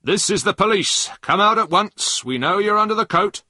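high, loud police command; said loudly. A narrator's reading. Driver pretending to be police to the hidden boy, loud. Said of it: "went up to the coat and said loudly".

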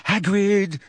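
put-on high, frightened girl's call; terrified, high-pitched. A narrator's reading. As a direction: plead his name in fear. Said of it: "in a high-pitched and terrified voice".

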